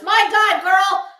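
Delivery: replaying her own shocked reaction, loud and high; exclaiming in shock. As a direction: gasp it out like you can't believe your eyes. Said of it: "what I said yesterday was".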